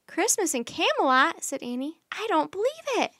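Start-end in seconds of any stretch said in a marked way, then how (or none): 0.1-1.4 high, loud, excited question; thrilled amazement. Acted. Annie thrilled by the invitation. 2.1-3.1 high, loud, swooping exclamation; thrilled disbelief. Acted. Annie thrilled by the invitation.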